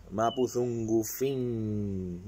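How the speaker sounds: "mapuzungufin" stretched out slowly, syllable by syllable; slow demo. Shown as a demonstration. Teacher models "mapuzungufin" for viewers, very slow. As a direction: draw out "mapuzungufin" for learners to copy. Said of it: "Yo lo hablo".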